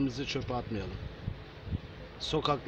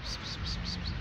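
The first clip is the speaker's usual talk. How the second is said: whispered cat call; 5 dB quieter than usual. Acted. Calling cats softly.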